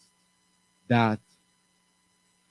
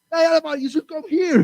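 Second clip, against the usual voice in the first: high calling voice, much higher; 5 dB louder than usual. Acted. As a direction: call across the savanna to the elephant. Animals calling the elephant.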